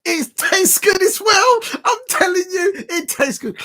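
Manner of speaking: high, loud ecstatic yelling, slowing down; yelling with delight, half crying out; ecstatic shout. Real, not acted. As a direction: shout it out in pure joy. Raving about the Wembley ticket.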